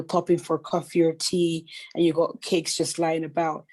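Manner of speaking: ordinary talk, nothing marked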